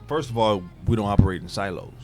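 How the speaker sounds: normal talking voice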